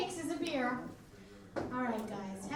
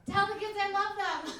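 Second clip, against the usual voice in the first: mock dramatic farewell; 7 semitones higher, 6 dB louder than usual. Acting own last words to husband.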